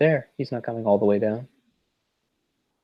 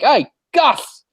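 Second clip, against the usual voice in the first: much higher Australian shout across the yard; Australian call-out; 4 dB louder than usual. Retold or imitated. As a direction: call over the fence in a mock Australian accent. Aussie calling out to neighbor.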